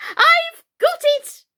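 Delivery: very high, loud, slow triumph; triumphant. Acted. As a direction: crow in victory. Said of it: "she cried in a triumphant tone".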